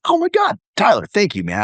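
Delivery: high-pitched retold burst of thanks; excited gratitude. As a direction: gush thanks to an old colleague. Retells grateful reaction to referral.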